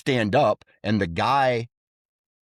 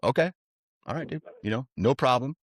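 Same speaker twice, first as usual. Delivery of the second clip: placating; 5 semitones higher, faster than usual. Retold or imitated. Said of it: "And I'm like".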